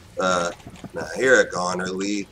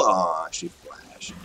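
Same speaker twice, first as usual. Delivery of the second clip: normal talking voice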